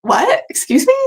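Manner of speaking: imitating a friend's high, baffled "What?"; mock disbelief. Friend teasing her over an old phrase, mock disbelief. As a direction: play the friend pretending to be shocked. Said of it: "And she's like".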